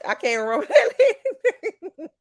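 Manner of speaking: high, laughing through the words; amused, laughing at her own blank memory. Real, not acted. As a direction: giggle while admitting it. Laughing at forgetting a name.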